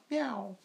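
high soft meow imitation; normal cat meow. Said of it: "like that".

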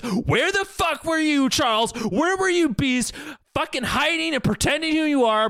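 high, heated rant building in anger; mock furious rant. Friend acting Magneto raging at Charles and Beast, mock furious high rant. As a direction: hurl each question at them. Magneto's angry speech, retold.

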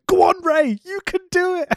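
high, loud cheering; cheering fan. Retold or imitated. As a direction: urge Rey on like a fan in the crowd. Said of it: "audibly ... going".

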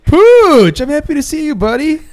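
high, gushing, hammed-up hello; mock gushing greeting. Real, not acted. Greeting the producer who just walked in, high mock gush. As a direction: greet him like a fawning fan.

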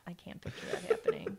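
giggling under breath; whispered laugh. Real, not acted. Laughing whisper after teasing.

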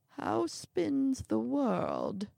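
put-on slow, high, drawn-out old man; leisurely elderly questioner; old man's greeting. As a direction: ask it grandly, stretching each word.

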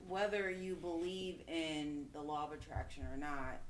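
ordinary delivery

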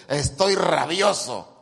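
slow, loud Jonah fuming at God; angry growl. Retold or imitated. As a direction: drag out the rage like a sulking prophet. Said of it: "Otra versión dice".

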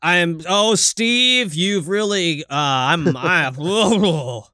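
lightly voiced grumpy old man; a hint of a cranky old man sputtering; mildly grumbling old man, half-joking; Walter Matthau. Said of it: "I become Walter Matthau".

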